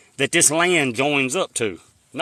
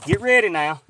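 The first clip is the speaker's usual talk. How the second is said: normal talking voice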